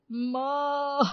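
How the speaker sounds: hammed-up wail; mock wail. Husband joins in wailing for his mother, mock cry.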